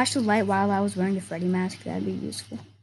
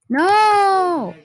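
scared scream; 7 semitones higher, 6 dB louder than usual. Real, not acted. Jump scare in horror game.

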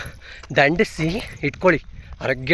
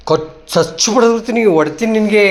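mock threat; 5 semitones higher, 5 dB louder than usual. Mock threat to playful dog.

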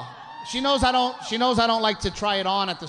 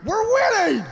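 put-on drunk excited call; 13 semitones higher, 3 dB louder than usual. Drunk husband calling to wife.

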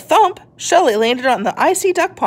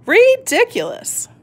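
much higher, slow mocking scoff; scoffing duck; 12 semitones higher than usual. The duck mocks the tortoise, high slow scoff. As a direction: drag it out with a sneer.

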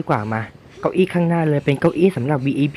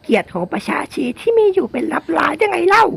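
very high, strained falsetto villager; ชาวบ้านประกาศกร้าว. Acted. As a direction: proclaim it shrilly, building to a shouted final jeer. (เสียงดัดแหลมสูง ประกาศด่าจนตะโกน)

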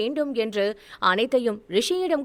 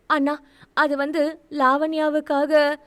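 hesitant sister; 4 semitones higher than usual. A narrator's reading. Sister hesitantly starts explaining.

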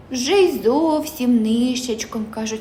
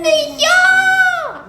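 very high, louder squeaky mouse; 6 dB louder than usual. A narrator's reading. Mouse refuses again, very high squeak. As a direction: sing it out like a tiny mouse.

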